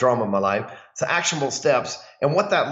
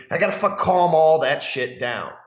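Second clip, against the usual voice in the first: usual speaking voice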